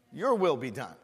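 ordinary delivery

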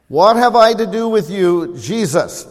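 loud, high demon's cry to Jesus. Acted. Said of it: "this is what they said to Jesus".

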